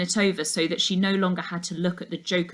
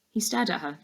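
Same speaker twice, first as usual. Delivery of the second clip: ordinary delivery, nothing marked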